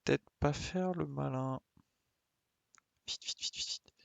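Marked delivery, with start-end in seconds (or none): none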